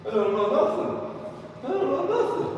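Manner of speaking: high loud panicky pleading; mock panicked suspect; panicked denial. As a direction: plead it, scared out of your wits. Other guy panicking under arrest.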